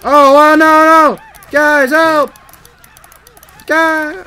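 high, full-volume cry for help; panicked screaming. Real, not acted. Player begging the guys for help, panicked screaming. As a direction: scream desperately for your teammates. Calls teammates for help in game.

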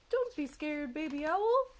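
high, loud singsong bear; big swooping storybook bear voice; bear character. A narrator's reading. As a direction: call it out high and cheery-silly. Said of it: "said Bear".

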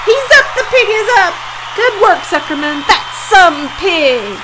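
high, loud jubilant shouts; yelled cheering. A narrator's reading. Crowd cheers the revived pig, high jubilant yelling. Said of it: "yelled the crowd".

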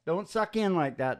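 ordinary talk

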